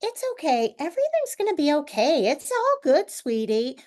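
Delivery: high, sweet comforting coo to her dog; soothing the dog. Retold or imitated. Owner comforting her dog, high sweet coo. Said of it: "Like, I'll say".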